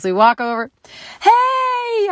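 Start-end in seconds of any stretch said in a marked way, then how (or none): 1.2-2.1 high excited call across the yard; high excited hello. Retold or imitated. I hear a voice.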